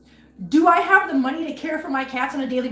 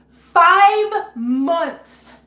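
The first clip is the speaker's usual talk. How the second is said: tearful; 5 semitones higher, 8 dB louder than usual. Real, not acted. Upset rant, cry heard.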